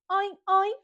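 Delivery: playful spoken pig oinks; imitación de cerdo. Shown as a demonstration. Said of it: "El cerdo hace". (gruñido juguetón de cerdo)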